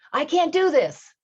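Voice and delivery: high, loud and slow; loud self-doubt. Retold or imitated. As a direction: cry it into the canyon. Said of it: "if you're saying to yourself".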